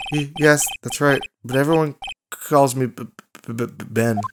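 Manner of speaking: stuttering timid man; nervous ventriloquist. Acted. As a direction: get stuck on the first sound, shyly. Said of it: "This guy's so nervous".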